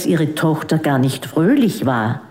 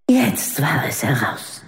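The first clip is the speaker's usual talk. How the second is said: whispered; 3 semitones lower, 4 dB quieter than usual. A narrator's reading. Narrator's hushed dramatic beat.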